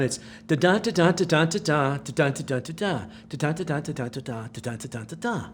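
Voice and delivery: da-da da-da repeated, fading away; imitated rhythmic noise. Showing the host the background noise, a fading rhythmic da-da. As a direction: chant the pulsing background noise, dying off. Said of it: "like that".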